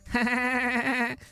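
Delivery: high, nasal honking laugh; staccato nasal cackle held on one high pitch; Fran Drescher. Retold or imitated. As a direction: do an exaggerated nasal New York laugh. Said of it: "You ever hear her laugh?".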